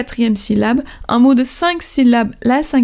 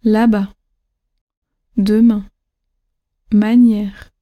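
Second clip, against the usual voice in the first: "Là-bas", "Demain", "Manière", each falling on its last syllable; là-BAS, deMAIN, maNIÈRE, gently descending; single words "Là-bas", "Demain", "Manière" with accented endings; last-syllable stress; 2 semitones lower, slower than usual. Shown as a demonstration. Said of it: "descend légèrement".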